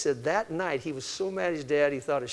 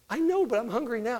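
put-on higher, petulant child protest; whiny toddler; 8 semitones higher than usual. Susie protesting to mommy before her tantrum. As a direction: whine as the fit starts. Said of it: "she throws a fit and a tantrum".

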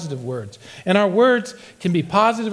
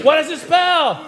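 high, slow, sinking cheer chant; gloomy cheer call; 11 semitones higher, 3 dB louder, slower than usual. Pretend. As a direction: lead a hopeless cheer, high and drawn out. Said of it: "pessimistic cheerleaders".

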